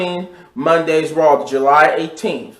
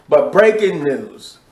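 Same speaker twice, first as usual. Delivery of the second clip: slow dramatic breaking news; announcer voice; 3 semitones higher, slower than usual. Shown as a demonstration. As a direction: announce it like a newscaster. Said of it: "voice, a little beat".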